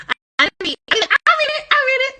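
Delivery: high, loud eager call of I read it; eager classmates. Retold or imitated. Classmates begging the teacher to be picked, high excited squeal. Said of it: "when people used to be like".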